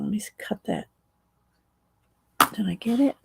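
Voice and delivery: ordinary talk, nothing marked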